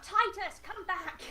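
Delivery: high-pitched call after someone; acted shout. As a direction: call them back urgently. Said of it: "She just shouts out".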